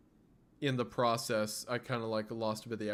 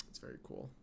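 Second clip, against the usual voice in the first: whisper; 12 semitones lower, 16 dB quieter than usual. Real, not acted. Sudden aside to chat.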